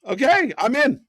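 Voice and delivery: loud, rising excitement; excited shout. Real, not acted. Co-host reacting to a double leg, excited and loud. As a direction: echo the call loudly, suddenly on board. Echoes the call, won over.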